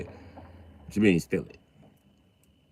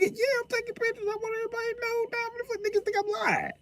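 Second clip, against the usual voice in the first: high, fast, breathless put-on voice; 10 dB quieter than usual. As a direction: squeal it like a giddy gossip. Voicing Leo at funeral.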